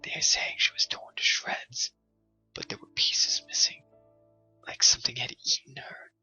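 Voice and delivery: hushed gossip whisper; woman whispering the news to the man. A narrator's reading. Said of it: "the woman whispered".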